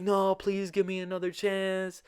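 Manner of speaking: high, whiny girlfriend imitation; hammed-up tearful plea; mock pleading. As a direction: beg in a put-on high voice.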